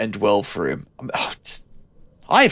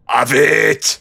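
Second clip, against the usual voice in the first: mock battle cry; 5 semitones higher, 6 dB louder than usual. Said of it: "I boot it and go".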